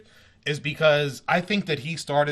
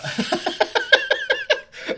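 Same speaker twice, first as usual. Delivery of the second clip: high burst of laughter. Real, not acted. Laughing at Eddie Murphy joke.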